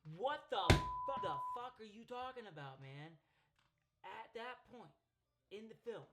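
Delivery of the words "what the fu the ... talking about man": outraged outburst. Real, not acted. Reviewer appalled by his answer about her dad, outraged shout. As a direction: explode in loud, stretched disbelief.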